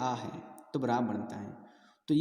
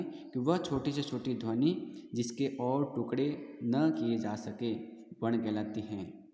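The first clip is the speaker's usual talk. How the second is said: dictating definition. Shown as a demonstration. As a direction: spell the definition out so it can be copied. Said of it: "definition repeated".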